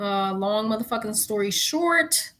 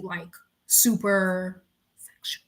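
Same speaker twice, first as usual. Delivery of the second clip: whispered aside; 3 semitones lower, slower than usual. Real, not acted. Confiding to viewers about the show, whispered. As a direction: drop to a whisper on "super sexual".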